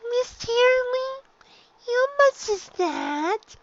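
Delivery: put-on high, slow small child's voice; a shy young filly, high and unhurried; little filly voice. Dicky asks teacher.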